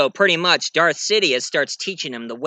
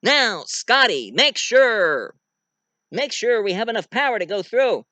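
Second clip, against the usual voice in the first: starship captain; 7 semitones higher than usual. Acted. Captain orders his engineer.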